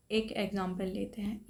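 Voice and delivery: normal talking voice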